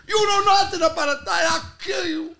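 high, loud mock furious shout, slowing at the end; old timer yelling. Old-timer threatening the young guy, high, loud and furious. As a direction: roar the threat like a hot-headed old man. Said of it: "He goes".

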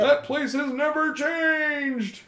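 high nebbish ghost voice; a nervous clerk ghost telling his death. Acted. Ghost explaining backstory, high.

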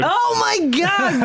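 drawn-out high exclamation; shocked groan. Real, not acted. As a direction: groan at a terrible pun. Groan at the pun.

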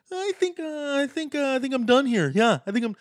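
high, hesitant mock quitter; Nate Diaz. Host mocking a fighter who wants out, exaggerated hesitant impression. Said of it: "didn't really say it like that".

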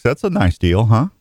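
usual speaking voice

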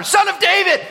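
very high, loud yell. Acted. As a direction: scream it at the top of your lungs. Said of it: "shouting even louder".

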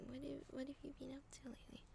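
barely audible, quick whisper; whispered read-through. Real, not acted. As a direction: read it to yourself under your breath. Reads the written line under breath.

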